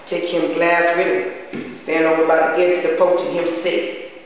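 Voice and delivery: ordinary delivery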